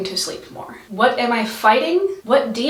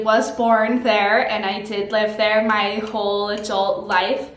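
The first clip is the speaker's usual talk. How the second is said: normal talking voice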